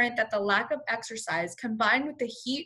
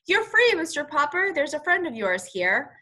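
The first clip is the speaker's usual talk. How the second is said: calling out; 5 semitones higher, 4 dB louder than usual. Acted. Jailer announces release.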